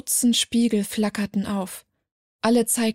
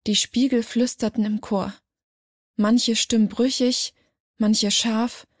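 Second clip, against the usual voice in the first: usual speaking voice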